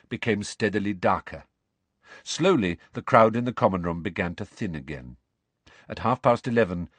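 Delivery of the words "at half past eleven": ordinary delivery, nothing marked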